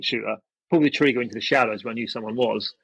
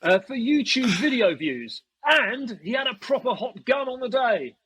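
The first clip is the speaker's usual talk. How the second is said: high, drawn-out imitation of a denouncer; mock outraged accusation; 9 semitones higher than usual. Field owner denouncing the player on livestream, mock outraged. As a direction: mimic a scandalised owner, pitched way up.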